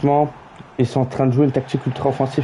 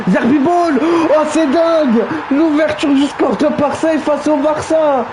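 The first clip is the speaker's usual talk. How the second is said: excited goal call; 10 semitones higher than usual. Real, not acted. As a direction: erupt as the goal goes in. Goal scored in the match.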